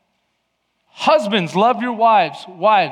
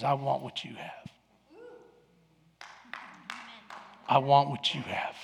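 much softer, low and earnest; earnest quiet confession; 5 semitones lower, 10 dB quieter than usual. Retold or imitated. Stranger on the beach quietly confessing to the preacher. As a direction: confide it gently. Stranger's plea quoted softly.

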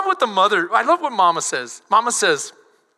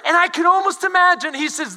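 usual speaking voice

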